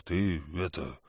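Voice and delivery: lightly voiced big hunter; a hint of a relieved big man; hunter's voice. Valu warns the boy.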